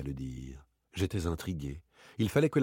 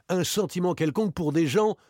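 higher, rising at the end; insistent challenge; 10 semitones higher, 5 dB louder than usual. Acted. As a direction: throw the words back as a challenge. Other party throws the words back. (insistant, sur un ton de reproche)

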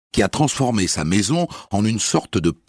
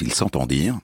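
usual speaking voice

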